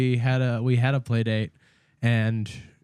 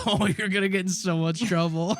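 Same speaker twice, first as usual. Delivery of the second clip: slightly higher mock-ominous taunt; teasing gloating; 4 semitones higher than usual. Eric taunting his friend, teasing gloating. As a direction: needle him with playful schoolboy glee. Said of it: "to be a dick, he was like".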